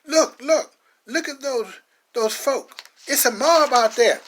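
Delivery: high, loud panicked call at the window; a churchgoer spotting the mob outside; alarmed cry. Acted. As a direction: shout the warning in fright. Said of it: "a parishioner cries out".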